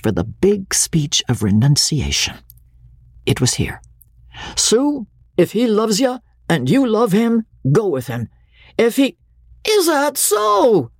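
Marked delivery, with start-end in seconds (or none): 4.6-9.2 stage melodrama. A narrator's reading. Stage renunciation speech again. 9.7-10.9 put-on slow, much higher amused drunk; amused drawl. A narrator's reading. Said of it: "drawled the amused voice".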